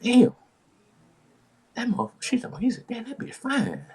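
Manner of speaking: high, impressed brother's voice. Retold or imitated. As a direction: act out his brother gawking. Voicing brother's reaction.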